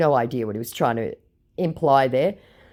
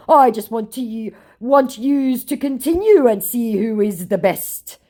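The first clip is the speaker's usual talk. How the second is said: mocked scammer; 7 semitones higher, 4 dB louder than usual. Scammer challenging the baiter to continue, mocking voice. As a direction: mimic his stumbling bravado. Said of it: "he says".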